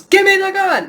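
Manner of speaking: very high, loud imitation yell; imitated yell. Reviewer proving the kids would hear it, mock yelling. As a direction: shout it like the man in the film. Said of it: "yelling at the top of his lungs".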